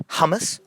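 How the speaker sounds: HUMMUS said as HA-mus, pitched high; wrong pronunciation. Shown as a demonstration. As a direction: turn the first vowel of HUMMUS into an A. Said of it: "not hummus".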